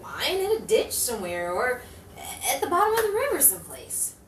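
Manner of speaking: reflective detective narration, measured; hardboiled narrator voice; noir voice-over. A narrator's reading. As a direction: muse back on the case to the listener.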